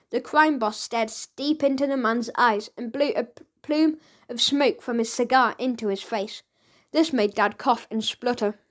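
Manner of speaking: ordinary delivery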